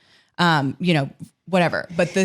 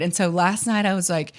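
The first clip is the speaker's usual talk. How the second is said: normal talking voice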